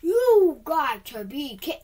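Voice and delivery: loud, exasperated cry, cut off mid-word; frustrated yell. Real, not acted. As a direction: shout it out in disbelief. Frustrated gamer yelling at game.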